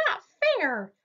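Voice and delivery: high indignant cry of not fair; mock shout from Hare, pitched high. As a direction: protest like a sulky child. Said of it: "shouted Hare".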